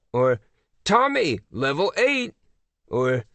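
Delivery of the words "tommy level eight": high, lilting call-out; announcing teacher. A narrator's reading. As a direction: announce it to the whole class like a prize. Teacher calls out level announcements.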